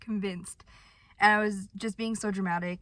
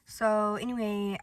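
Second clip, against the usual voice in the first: normal talking voice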